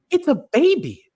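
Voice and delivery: put-on high, exasperated "It's a baby."; incredulous retort. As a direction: state the obvious in disbelief. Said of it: "I'm like".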